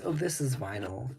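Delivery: ordinary talk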